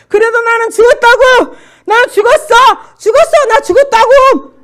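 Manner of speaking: high, relentless full-voice scream; screaming repeatedly. Retold or imitated. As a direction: keep shrieking your defiance over and over. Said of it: "소리를 계속 지르었어요".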